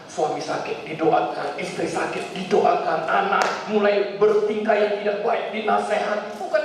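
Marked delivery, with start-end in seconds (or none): none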